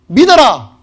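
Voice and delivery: 믿어라 at full preaching force; loud exhortation. Acted. Preacher's climactic call. (힘차게 외치는 설교 목소리)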